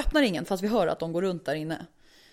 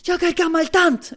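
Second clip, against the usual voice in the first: high, slow, loud protest; agitated neighbour; 8 semitones higher, 8 dB louder, slower than usual. Retold or imitated. Neighbour pleading old age in quarrel, agitated wail. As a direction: stretch it into an imitated wail.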